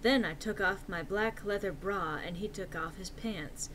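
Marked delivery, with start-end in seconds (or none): none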